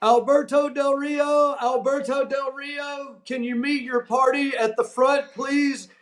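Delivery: high-pitched store paging voice; mock PA call, held high throughout; store PA announcement. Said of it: "over the P A, you hear".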